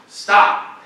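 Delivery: commanding stop. Retold or imitated. Sheikh halting a speaker, commanding stop. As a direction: cut in with one loud word.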